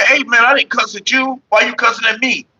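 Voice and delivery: high, put-on whiny protest; mocking whiny voice. Mocking the man who says he didn't cuss, high and whiny. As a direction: mimic the offended guy in a high voice. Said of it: "they say".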